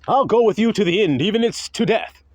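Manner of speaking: lightly voiced brash disciple; boastful Peter. Preacher playing Peter swearing loyalty, mild bravado.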